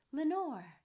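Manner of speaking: loud, high call falling away; echoed cry. A narrator's reading. Echo answering the man's word from the dark, loud falling cry. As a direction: send the name ringing back as an echo. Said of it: "an echo murmured back the word".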